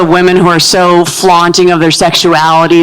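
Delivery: normal talking voice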